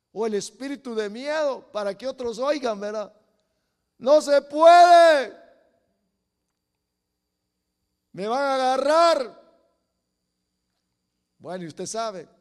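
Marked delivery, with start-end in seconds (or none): 4.0-9.4 loud, slow, panicked cry; fearful shout. Acted. Preacher voicing spirits crying out in fear, loud panicked shout. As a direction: yell it like a terrified spirit.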